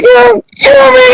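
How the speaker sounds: very high, fast squeal of disbelief; hammed-up high-pitched cry; mock panicked yelp. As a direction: squeak it out like you've been abandoned. Left alone at the battle.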